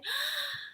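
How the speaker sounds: sharp high gasp of amazement; surprised gasp. Acted. Crow gasping as he greets the dove.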